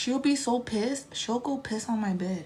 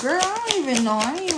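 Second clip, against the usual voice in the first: higher, louder excited reaction; excited outburst; 7 semitones higher, 7 dB louder, faster than usual. Real, not acted. Vlogger reacting to a viewer's comment, excited. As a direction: burst out at the chat.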